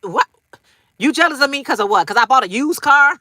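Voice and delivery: indignant. Retold or imitated. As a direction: scoff at the jealousy. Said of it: "You like".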